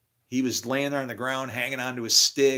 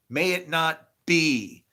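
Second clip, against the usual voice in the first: solemn pronouncement; 5 semitones higher, 3 dB louder, slower than usual. Real, not acted.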